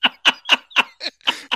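high rising laugh. Real, not acted. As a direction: keep laughing at the joke. Laughing after punchline.

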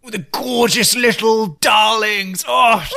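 high, drawn-out gushing coo; Lisa Vanderpump. Acted. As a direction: gush loudly over adorable little pets. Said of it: "It was very Lisa Vanderpump of them".

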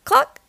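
very high, short cluck; chicken cluck. A narrator's reading. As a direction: cluck like a hen. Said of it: "said a chicken".

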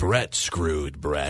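slow, deep gravelly boss voice; Vince McMahon impression. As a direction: drag it out low like Vince. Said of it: "as Vince says".

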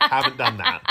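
high, laughing confession; laughing admission. Retold or imitated. Admitting to the podcast co-host she never set the reminder, laughing. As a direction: giggle it out at yourself.

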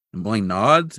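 ordinary talk, nothing marked

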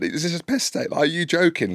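high, playful mock disbelief; joking. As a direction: ask it high, as banter. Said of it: "as in, in a joke".

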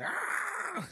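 high piercing [name] ad-lib squawk; pterodactyl shriek. Retold or imitated. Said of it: "that, like, pterodactyl sound".

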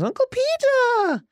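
put-on high pampered child calling out to his uncle; Sweet Robin voice. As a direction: play a whiny little boy greeting his uncle. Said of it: "And Robin goes".

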